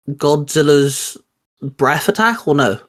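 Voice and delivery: ordinary talk, nothing marked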